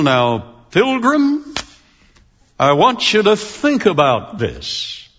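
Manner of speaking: slow cowboy drawl, sliding high on Pilgrim; a deliberately bad John Wayne. Preacher mimics John Wayne to make a point about speech, mild slow drawl. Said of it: "with a John Wayne drawl".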